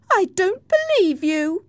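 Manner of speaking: loud, very high-pitched refusal; defiant denial. Acted. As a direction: refuse hotly to hear the warning. Isabella rejects Nelly's warning.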